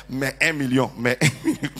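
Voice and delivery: "mais un million" repeated with a laugh; amused mimic of the mistake; mocking wrong phrase. Shown as a demonstration. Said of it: "on ne dit pas".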